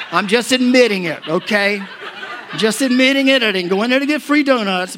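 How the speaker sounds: loud, high comic protest; playfully owning up at full voice; mock defensive. Real, not acted. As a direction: protest loudly over the laughter. Comic self-defence amid audience laughter.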